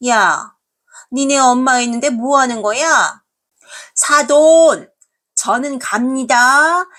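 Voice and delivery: loud, high, slow mother-in-law jab; a haughty mother-in-law drawing out her goodbye; snide mother-in-law. Acted. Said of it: "하면서 어머님은 나가십니다".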